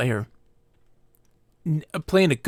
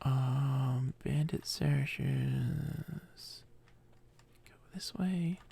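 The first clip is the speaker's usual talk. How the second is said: whisper; 12 dB quieter, much slower than usual. Real, not acted. Muttering to herself while playing.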